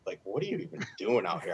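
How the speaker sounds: low, soft imitation of a teammate; hushed, low quoted aside; quiet disbelief. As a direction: ask it under your breath, baffled. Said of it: "He's like".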